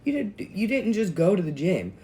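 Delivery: husband scolding his wife after the gym, loud and exasperated. Retold or imitated. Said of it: "my husband even was like".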